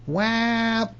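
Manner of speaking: high, comic muffled noise of a mouth being plugged; mock stuffed-mouth noise. Narrator mimics the toddler with a cookie, comic noise.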